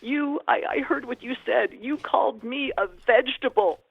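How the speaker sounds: high, loud wounded accusation; mock-distraught, accusing wail; retold sobbing. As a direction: imitate a patient crying out in hurt. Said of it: "He's absolutely crying hysterically".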